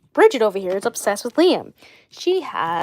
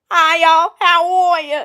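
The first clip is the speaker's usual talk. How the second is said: loud, higher Alabama greeting; Alabama farm-girl accent; 7 semitones higher, 7 dB louder, slower than usual. Acted. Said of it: "that's Bridget's accent for ya".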